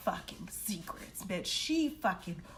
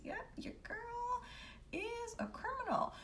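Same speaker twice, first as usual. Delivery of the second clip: teasing whisper; 13 semitones higher, 8 dB quieter than usual. Real, not acted. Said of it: "teasing next episode".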